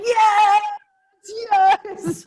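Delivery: loud, high cheering yes; excited shout. Retold or imitated. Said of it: "Sharon is going".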